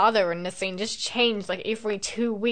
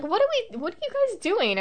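puzzled passerby; 11 semitones higher, faster than usual. Retold or imitated. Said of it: "they're like".

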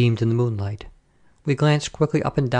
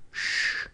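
soft voiceless shush; quiet, drawn-out hush; hushing shh; 8 dB quieter than usual. A narrator's reading. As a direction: shush them softly. Hushing while police pass.

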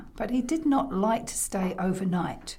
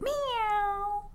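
soft, high meow; cat meow; 11 semitones higher, 5 dB quieter than usual. Acted. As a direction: imitate a little cat mewing. Imitates the cat.

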